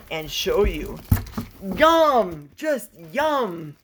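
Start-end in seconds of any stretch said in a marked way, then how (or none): none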